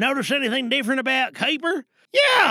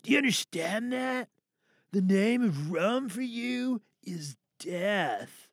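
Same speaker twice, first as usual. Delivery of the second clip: quiet menace; 7 dB quieter than usual. Acted. Quiet menacing warning, then repeated shouted.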